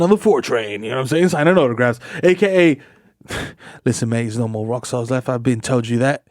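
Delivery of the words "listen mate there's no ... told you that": mock London rocker accent; lightly put-on British accent. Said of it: "come from across the pond".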